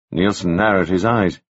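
ordinary delivery, nothing marked

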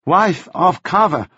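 a flat, unhelpful reply, slowly; unhelpful foreigner. A narrator's reading. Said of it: "said Ah Suk unhelpfully".

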